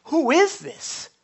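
awed question. Retold or imitated. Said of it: "And they asked".

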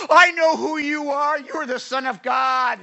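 high, loud possessed cry; demon voice. Retold or imitated. The demon crying out to Jesus in the synagogue, high and loud. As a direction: shriek it like a demon. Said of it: "That demonic spirit".

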